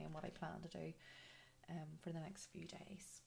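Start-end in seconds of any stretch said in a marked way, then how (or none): none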